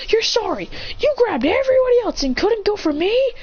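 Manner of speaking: hurt, high indignant retort. Acted. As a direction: throw it back at the father. Son confronts father angrily.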